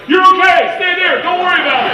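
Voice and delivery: very high, loud reassurance yelled; mock-soothing shout. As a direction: shout the comforting words absurdly. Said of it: "It doesn't sound like".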